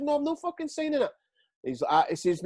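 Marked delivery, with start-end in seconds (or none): 0.0-1.1 pitched high defiant retort; swearing back. Retold or imitated. Player defying manager over contract, high defiant. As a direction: fire it back at the boss. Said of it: "I actually swore back".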